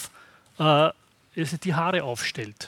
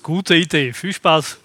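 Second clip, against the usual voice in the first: sarcastic; 10 dB louder than usual. Acted. Mocking going it alone.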